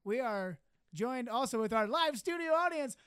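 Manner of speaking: usual speaking voice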